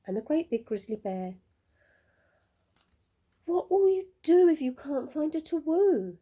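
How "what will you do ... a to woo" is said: higher, louder, lightly voiced question; animals asking. A narrator's reading. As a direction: ask it as a worried crowd of friends. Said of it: "they asked".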